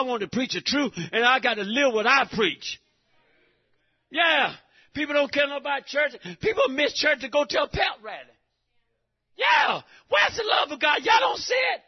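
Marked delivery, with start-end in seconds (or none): 4.1-11.8 high, loud climactic shouting; shouting to the church, voice climbing high; rising preacher shout. Real, not acted. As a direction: lift it to a fever pitch.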